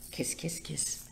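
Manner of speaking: soft unvoiced kiss kiss kiss; whispered kisses. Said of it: "I forgot the part".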